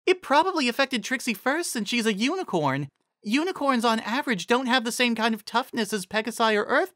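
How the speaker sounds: a patient pony explaining magic; lightly voiced pony mare. A narrator's reading. Carrot Top explains poison joke to friends, lightly voiced mare.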